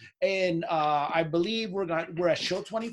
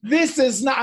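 mock shout; 8 semitones higher, 7 dB louder than usual. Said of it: "He said".

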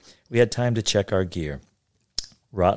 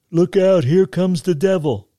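high, loud alarmed call; warning shout; 10 semitones higher, 7 dB louder than usual. A narrator's reading. Boy warns the others about the devil, shouting. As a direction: shout it out like a kid raising the alarm. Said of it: "he shouted".

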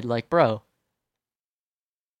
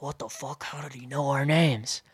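hushed, stunned mock disbelief at first; shocked. Couple hearing their names from a stranger, shocked. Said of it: "like, shocked".

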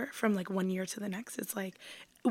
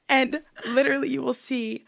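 breaking into laughter; laughing through the words; 6 semitones higher, 8 dB louder than usual. Real, not acted. Laughing fit mid-sentence.

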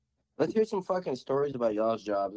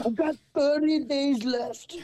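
mock dying lady; 13 semitones higher, slower than usual.

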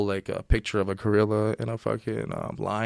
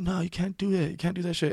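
higher, softer mock protest; plaintive retelling, pitched up and hushed; retold tearful protest; 7 semitones higher, 5 dB quieter than usual. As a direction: lightly imitate protesting through tears. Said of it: "I just started crying".

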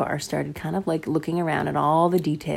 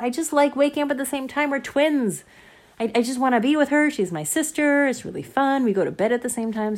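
put-on eager boy; 7 semitones higher, 3 dB louder than usual. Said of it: "He said".

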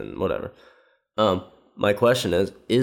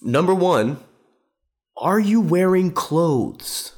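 high and slow, dragging each word; mock pompous question; 8 semitones higher, 3 dB louder, slower than usual. Mocking the host's questions to a dead father, pompous. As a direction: ham up a smug lecturer listing questions. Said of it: "He goes, he goes".

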